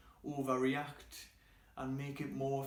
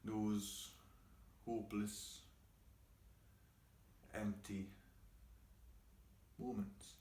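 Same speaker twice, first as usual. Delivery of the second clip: bleak, hushed; 2 semitones lower, 11 dB quieter than usual. Acted. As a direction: let each word drop quietly, with long gaps. Bleak line in performed monologue.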